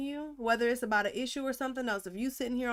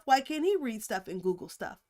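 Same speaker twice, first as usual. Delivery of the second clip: normal talking voice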